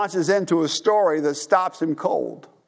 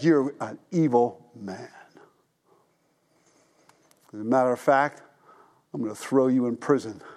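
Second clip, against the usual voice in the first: stern king; 7 semitones lower, 4 dB quieter than usual. Retold or imitated. King condemning the unforgiving servant, low and grim. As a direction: pass sentence coldly from the throne.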